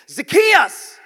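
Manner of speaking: loud, high call by name. Acted. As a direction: call up to someone in a tree. Jesus calls up to Zacchaeus.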